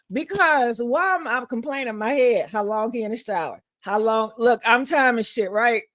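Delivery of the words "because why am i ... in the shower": normal talking voice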